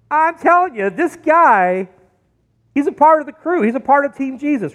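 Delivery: high, punchy telling on someone; insistent accusation. Acted. Servant girl tells the bystanders, insistent accusation. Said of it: "this girl says to the bystanders".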